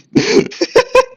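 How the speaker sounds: a sudden high-pitched burst of laughter; real amused laugh; loud high laugh. Real, not acted. Laughing at own conversation.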